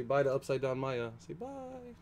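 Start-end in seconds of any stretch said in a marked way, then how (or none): 1.2-1.9 pet talk. Real, not acted. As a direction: coo it sweetly to the little animal. Talking to pet in baby voice.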